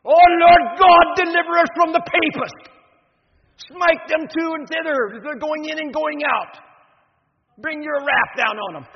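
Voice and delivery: put-on booming, high-pitched Irish-accented imprecation; Irish brogue. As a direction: thunder it out like a zealous Northern Irish student praying against papists. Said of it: "said in his Irish brogue".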